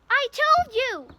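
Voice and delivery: high, loud, slow gasping cry; gasped. A narrator's reading. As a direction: gasp it out, breathless from running. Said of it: "Hermione gasped".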